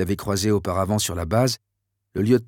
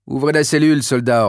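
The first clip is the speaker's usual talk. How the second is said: a louder, commanding order; general's order; 3 semitones higher, 5 dB louder than usual. Acted. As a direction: bark the order at the soldier. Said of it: "ordonna le général".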